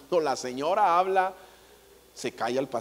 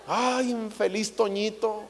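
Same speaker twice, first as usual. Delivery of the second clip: normal talking voice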